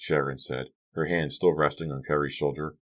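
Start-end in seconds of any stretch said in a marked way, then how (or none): none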